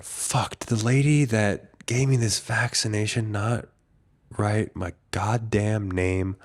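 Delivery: whispered fuck, then soft, slowing to the end; frustrated mutter. Retold or imitated. Vaccine record lacks his name, muttered frustration. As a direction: grumble it under the breath.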